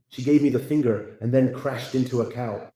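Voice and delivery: ordinary talk, nothing marked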